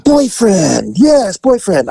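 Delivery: high, bright broken-English voice; delighted masseuse. Retold or imitated. Masseuse greeting returning client, high. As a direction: play her recognizing him, high.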